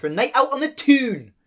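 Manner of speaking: high, lilting Geordie; sing-song Geordie; Geordie accent. Shown as a demonstration.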